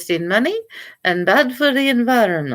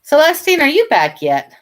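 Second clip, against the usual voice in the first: raised voice calling across the house; 5 semitones higher, 5 dB louder than usual. Real, not acted. Seller calling her cat from the packing table, loud call. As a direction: call her name from the next room.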